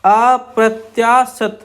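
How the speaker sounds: अप्रत्याशित spoken slowly, syllable by syllable; drawn out while writing. Shown as a demonstration. Teacher showing the outline stroke by stroke, slowly. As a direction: stretch अप्रत्याशित while drawing it. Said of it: "देखिए कैसे बनाएंगे".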